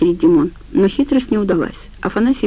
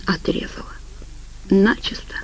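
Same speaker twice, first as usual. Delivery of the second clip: faint, tearful whisper; broken, whispered answer through tears; 8 dB quieter than usual. A narrator's reading. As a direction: say it with a tear in the voice, barely aloud. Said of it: "со слезой в голосе ответил".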